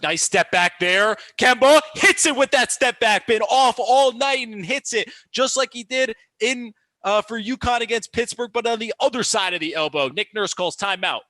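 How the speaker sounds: loud, high, surging basket call; excited play-by-play. Real, not acted. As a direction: burst into a thrilled commentary as the shot drops. Live call of made basket.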